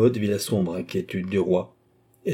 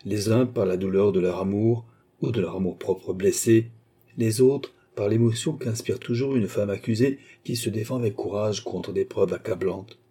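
usual speaking voice

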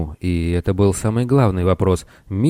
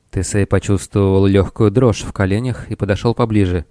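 usual speaking voice

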